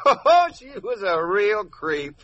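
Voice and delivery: laughing. Acted. Husband laughing at his trick.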